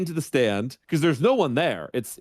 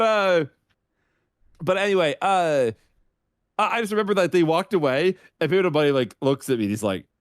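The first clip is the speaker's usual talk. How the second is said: normal talking voice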